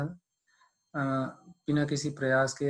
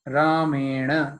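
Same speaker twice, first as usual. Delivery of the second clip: very slow, louder chanted word; Sanskrit recitation; 6 dB louder, much slower than usual. Shown as a demonstration. Teacher chants one word of the verse, slow. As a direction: draw रामेण out slowly.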